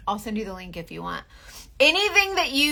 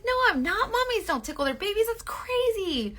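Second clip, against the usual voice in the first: playful denial; 11 semitones higher than usual. Retold or imitated. Mom denying tickling her baby, high teasing voice. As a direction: deny it with a grin.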